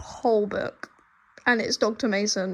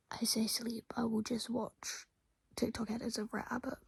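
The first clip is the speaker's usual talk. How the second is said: soft unvoiced whisper; hushed wry whisper; 10 dB quieter than usual. Real, not acted. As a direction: confess it quietly, tongue in cheek. Self-mocking bedtime confession.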